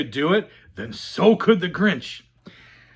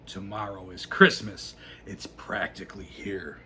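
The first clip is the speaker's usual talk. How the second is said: low, soft Grinch sneer; sneering snarl; 9 semitones lower, 9 dB quieter than usual. A narrator's reading. Grinch sneering at the Whos, a low snarl. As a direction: sneer it out, quieter and lower. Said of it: "he snarled with a sneer".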